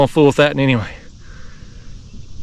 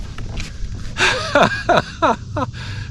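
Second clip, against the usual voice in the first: high, airy run of chuckles; delighted laugh; 13 semitones higher, 7 dB quieter than usual. Real, not acted. Angler admiring big flathead, high delighted laugh.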